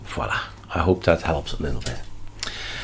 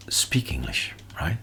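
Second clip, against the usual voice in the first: whispering; 5 semitones lower, 10 dB quieter than usual. Real, not acted. Aside of advice to viewers.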